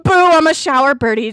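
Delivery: loud, playful jump-scare boo; startling boo. Pretend. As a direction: pop out to startle someone. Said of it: "Did I scare you?".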